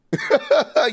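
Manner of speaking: loud high laugh at the critics; burst of high laughter. Real, not acted. To his cohost about the critics, a high burst of laughter. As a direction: cackle out loud.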